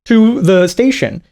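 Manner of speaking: ordinary delivery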